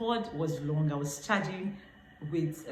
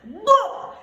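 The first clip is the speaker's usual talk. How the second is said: high, loud cry of alarm; shouted No; 12 dB louder than usual. Retold or imitated. Passenger seeing the axe coming, shouted. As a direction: shout it out as the attack comes. Acts out scream at robber with axe.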